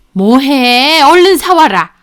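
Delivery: loud, impatient mother-in-law command; bossy order. Acted. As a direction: order them off on an errand. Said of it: "하면서 저한테 시키더라고요".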